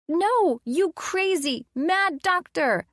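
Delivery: put-on high, slow child's protest; squealing child. Little boy fending off the mad doctor, squealing. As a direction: shout it like a giggling little boy. John shouting at Colin.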